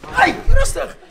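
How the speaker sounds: high, loud yelp to stop; calling a halt. Real, not acted. Host begs the boy to ease off, shouting. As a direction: shout to calm them down, half laughing.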